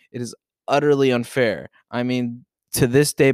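normal talking voice

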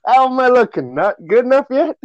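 high, loud teasing voice; mock flirty guy. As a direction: fish for compliments, hammed up.

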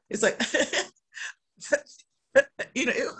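high, fast words through a wheezy laugh; breathless giggling; laughing breathlessly. Real, not acted. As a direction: talk while the laugh takes your breath. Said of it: "just to hear myself laugh".

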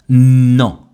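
loud, held N at the start of "Non"; insistent N. Shown as a demonstration. Teacher isolates the second syllable for learners, insistent long N on "Non". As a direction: lean long and hard on the N of "Non". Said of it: "en insistant sur la prononciation du N".

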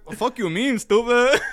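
mock indignant. Diner answering offer of a to-go box, mock indignant. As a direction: snap it back in comic outrage. Acted retort to waiter.

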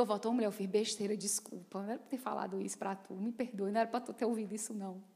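hurried, hushed sorry. Retold or imitated. Preacher quietly apologizing to a woman he hurt. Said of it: "eu digo".

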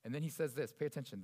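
ordinary delivery

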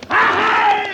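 loud high yell to spur the horse; riding yell. Acted. Jingles galloping off to lead the gang away, yell. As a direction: whoop to set the horse running.

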